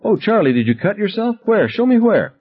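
high, anxious mother's voice; worried mother. A narrator's reading. Mother checking her son for cuts, high and anxious. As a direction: fuss over the boy, frightened.

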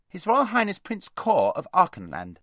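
high, loud announcement; voice from outside. A narrator's reading. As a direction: call the title through the gate. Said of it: "said a voice from outside".

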